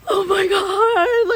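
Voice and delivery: gasp, then a high, slow cry of Oh my God; excited gasp. Retold or imitated. Squealing to her friend at the elevator, high and excited.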